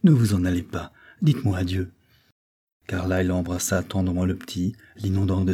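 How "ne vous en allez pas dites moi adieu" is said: ordinary delivery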